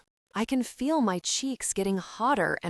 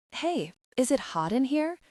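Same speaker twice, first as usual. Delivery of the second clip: sudden husky; 6 semitones higher than usual. A narrator's reading. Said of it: "in a sudden husky voice".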